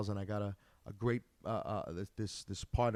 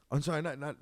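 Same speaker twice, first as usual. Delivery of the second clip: ordinary delivery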